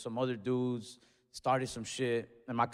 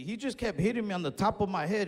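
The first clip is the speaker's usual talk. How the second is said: normal talking voice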